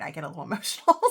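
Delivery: laughing through rising emotion; emotional laugh. Real, not acted. Vlogger admits she gets emotional over gifts, emotional laugh. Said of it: "trying not to get emotional".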